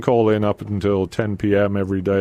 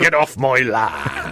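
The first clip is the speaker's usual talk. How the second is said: slow laughing West Country farmer; Devonian farmer accent; 2 semitones higher, slower than usual. Pretend. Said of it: "Devonian farmer?".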